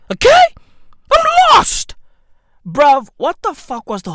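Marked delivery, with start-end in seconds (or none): 0.1-1.9 loud, high-pitched yell; high shout. Acted. Comic rant, shouted high.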